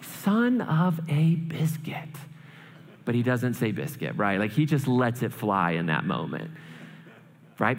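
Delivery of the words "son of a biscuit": slow, whispered curse; frustrated swearing. Acted. Boy alone in the bathroom, whispering a frustrated curse. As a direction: let it out slowly under your breath. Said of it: "biggest moment of frustration".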